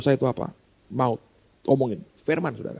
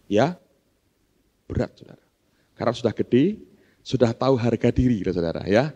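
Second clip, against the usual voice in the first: ordinary delivery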